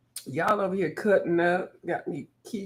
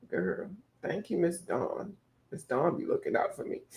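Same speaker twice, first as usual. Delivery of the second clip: usual speaking voice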